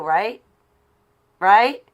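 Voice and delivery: very loud, high rising "Right?"; insistent challenge. Real, not acted. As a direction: fire it out, demanding agreement. Pressing the audience to agree.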